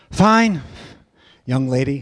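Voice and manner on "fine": higher, louder, clipped; curt fine. Retold or imitated. Group member cutting in, curt and fed up. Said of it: "another group member".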